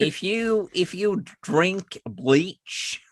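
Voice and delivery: high, slow mock presidential advice; exaggerated slow impression of a president; Donald Trump. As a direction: imitate a halting politician, drawing out the words. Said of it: "Did you watch Donald Trump for the last four years?".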